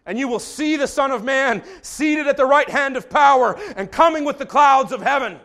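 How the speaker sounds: high, loud proclamation; Jesus shouting back. Retold or imitated. Jesus answering the high priest, loud shout. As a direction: fling it back with full force. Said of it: "shouts back at him".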